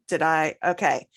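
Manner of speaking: normal talking voice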